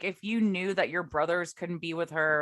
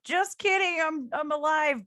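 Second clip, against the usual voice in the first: cheerful grandpa; 10 semitones higher, 3 dB louder than usual. Retold or imitated. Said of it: "he appears and he's like".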